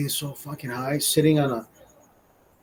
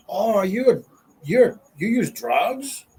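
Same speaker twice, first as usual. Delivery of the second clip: high, drawn-out mock disapproval; mock judgmental questioning; 5 semitones higher, slower than usual. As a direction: imitate a scandalized busybody, stretching it out. Mimics judgmental people back then.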